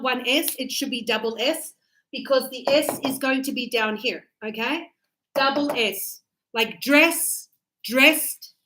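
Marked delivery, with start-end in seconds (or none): none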